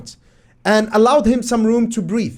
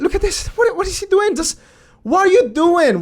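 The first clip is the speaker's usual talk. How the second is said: squeaky, high disbelief; can't believe what he's seeing; high exasperated what-are-you-doing; high incredulous outrage; 9 semitones higher than usual. Real, not acted. Exasperated at player's clip.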